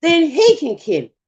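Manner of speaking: high-pitched, careful pleading; respectful protest. Retold or imitated. Answering an elder about the snake, respectful protest. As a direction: object politely, feeling out the words. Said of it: "being that I respect my elders".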